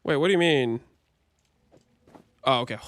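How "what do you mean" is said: ordinary delivery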